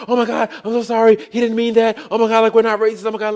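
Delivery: nervous guy begging forgiveness; panicked apology. Retold or imitated. Drunk's friend apologizing in panic.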